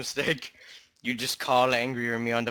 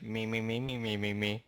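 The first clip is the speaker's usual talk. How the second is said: put-on drunk mumble; 2 semitones lower, 9 dB quieter than usual. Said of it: "When he speaks drunk, he's like".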